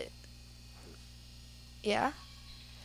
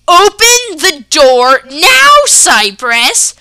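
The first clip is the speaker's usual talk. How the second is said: much louder, high, slow angry order; furious yell; 6 semitones higher, 14 dB louder, much slower than usual. Retold or imitated. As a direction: yell at your daughter to open up. Dad yelling order.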